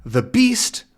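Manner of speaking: "The beast?" shooting high in alarm; a startled boy catching the word. Acted. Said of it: "Tintin says".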